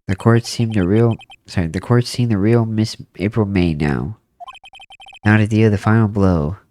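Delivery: ordinary talk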